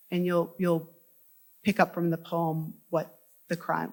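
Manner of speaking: ordinary delivery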